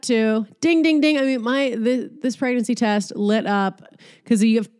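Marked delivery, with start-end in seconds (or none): 4.2-4.7 a burp caught mid-phrase. Real, not acted. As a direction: burp while talking. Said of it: "Excuse me, I'm burping".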